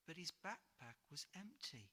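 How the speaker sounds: plain reading voice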